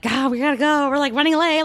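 high, frantic late-for-work panic; panicked rush. Retold or imitated. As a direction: hurry it out in a panic. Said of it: "that rush in the morning of".

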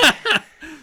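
big high burst of laughter; loud high laugh. Real, not acted. Host laughing at own crude joke, high loud laugh. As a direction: cackle at his own joke.

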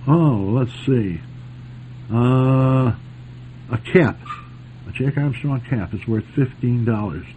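normal talking voice

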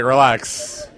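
amused dismissive relax; laughing retort. Retold or imitated. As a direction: shrug the guard off laughing.

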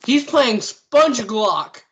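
high, loud and drawn-out exclamation; excited outburst. Real, not acted. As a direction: blurt it out in loud, slow amazement. Blurts out a surprise discovery.

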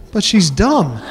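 ordinary talk, nothing marked

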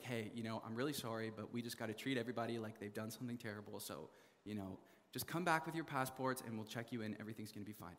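soft, low, gently apologetic voice; kind reassurance. Retold or imitated. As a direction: quietly soothe the scared kids. Kind lady reassures them.

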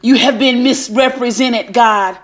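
normal talking voice

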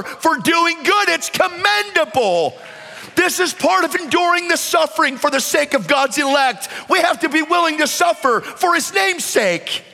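high-pitched, full-force sermon delivery; impassioned preaching. Real, not acted. Preacher urging the church to suffer for doing good, impassioned. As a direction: ring it out high and hard, like a fired-up preacher.

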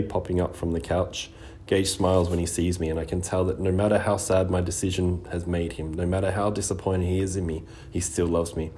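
plain reading voice

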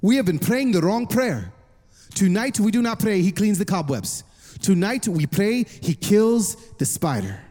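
low, deliberate pronouncement; firm pastor. Retold or imitated. Pastor corrects Miss Ramirez's prayer, firm and deliberate. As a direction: declare it firmly, slowing down at the end. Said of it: "looked her square in the eyes".

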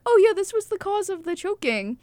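tossing out the cause; paramedics' report. Retold or imitated. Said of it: "they're like".

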